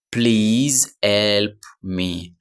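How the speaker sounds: Please help me drawn out very slowly; very slow teacher model of Please help me; slow model phrase. Shown as a demonstration. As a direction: stretch each word of Please help me for learners.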